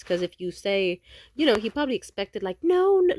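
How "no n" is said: fawning denial. Retold or imitated. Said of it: "like, fawn response".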